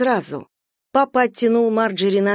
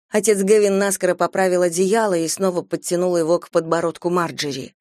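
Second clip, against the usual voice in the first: plain reading voice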